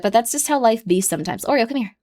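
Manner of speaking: ordinary talk, nothing marked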